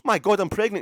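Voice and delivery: loud, high blurt of the news; just shout it out, no fuss; blurted announcement. Retold or imitated. Mock woman blurting out her pregnancy loudly. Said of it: "somebody who goes".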